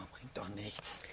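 breathy muttered whisper under the breath; a sulky whispered aside. Acted. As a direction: mutter it away so nobody hears. Muttered asides after accusation.

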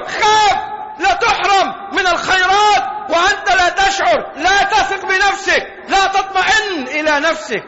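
fiery sermon warning; preaching at full force. Acted.

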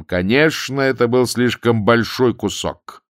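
plain reading voice

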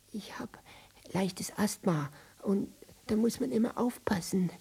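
breathy asthmatic whisper; wheezy whisper. Acted. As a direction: breathe the words out.

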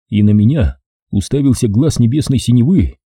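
usual speaking voice

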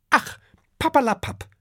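high-pitched dragon wife brushing it off; female dragon dismissing. A narrator's reading. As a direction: wave him off in a high voice. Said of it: "erwidert Adele". (hohe, abwinkende Drachinnenstimme)

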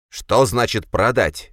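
offended brother protesting; indignant. A narrator's reading. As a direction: draw the question out in indignation. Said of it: "возмутился братец".

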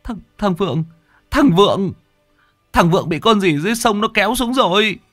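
terrified stammer. Acted. Young man reporting his friend dragged under, stammering in terror. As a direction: stutter out the news, still shaking with fear. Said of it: "ấp úng".

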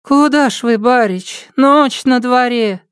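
put-on high, drowsy girl's voice; a sleepy maid calling out; sleepy servant girl. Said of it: "пробормотала".

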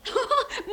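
a short amused chuckle; quick playful giggle; light teasing laugh. Acted. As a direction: laugh softly at his expense. Teasing the commissaris.

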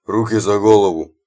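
low booming order; deep bass mercenary. A narrator's reading. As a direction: boom it like a big thug. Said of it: "пробасил наёмник сзади".

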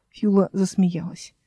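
ordinary delivery

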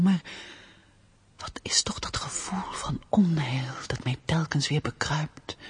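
intense stage whisper, mostly voiceless; anxious soliloquy. Acted. Woman finally alone, fearing the stranger at supper, anxious whisper. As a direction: breathe out the secret dread, with long pauses between thoughts. Alone, confesses secret dread.

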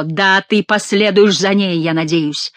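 plain reading voice

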